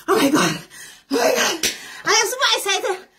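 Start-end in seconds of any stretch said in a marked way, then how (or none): none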